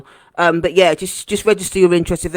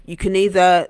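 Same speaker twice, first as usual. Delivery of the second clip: ordinary delivery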